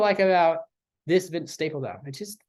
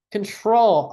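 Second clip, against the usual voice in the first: excited exclamation; 6 semitones higher, 6 dB louder than usual. Real, not acted. Repeats song title with excitement.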